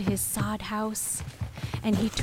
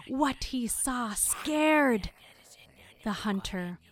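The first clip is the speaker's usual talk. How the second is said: ordinary delivery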